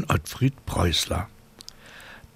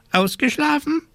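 high, fussy scolding raven voice; 4 dB louder than usual. A narrator's reading. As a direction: play a worried, scolding raven. Raven asks.

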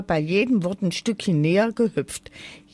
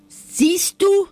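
viel höher und lauter, zornig aufschreien; zorniger Schrei; 12 semitones higher, 5 dB louder than usual. A narrator's reading. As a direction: der kleine Elf schreit wütend los. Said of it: "schrie der kleine Elf zornig". (der Elf schreit die Drossel zornig an)

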